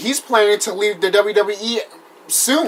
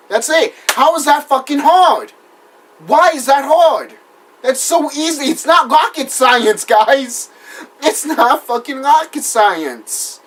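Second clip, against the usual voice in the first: higher, louder, fed-up rant; exasperated outburst; 6 semitones higher, 4 dB louder than usual. Real, not acted. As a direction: vent it at the bookers, loud and high.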